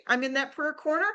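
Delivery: ordinary talk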